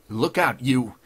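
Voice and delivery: loud, sharp warning; angry warning. A narrator's reading. Provoked gunman warning the judge, loud and angry. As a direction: burst out in threat. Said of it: "He ripped out a stinging oath".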